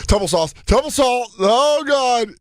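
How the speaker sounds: high, rising cry that ends in a shout; mock panic. Kid toppling over at the snap, high panicked shout. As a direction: yelp it as you tumble forward.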